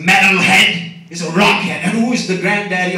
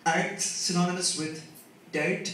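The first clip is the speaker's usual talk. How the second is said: usual speaking voice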